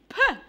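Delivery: short puffed pop; dummy popping out. Retold or imitated. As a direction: spit it out like a cranky baby. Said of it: "and the dummy went".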